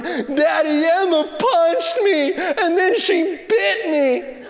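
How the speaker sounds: high, drawn-out mock bawling; slow wailing, hammed up like a sobbing kid; crying child. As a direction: imitate a hysterical little boy tattling. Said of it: "he's crying, his tears are everywhere".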